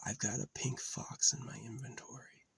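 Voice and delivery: hushed whisper of the repeated line; secretive whisper. Shown as a demonstration. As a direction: breathe it out in a whisper. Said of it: "repeats line in a whisper".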